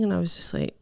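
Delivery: ordinary talk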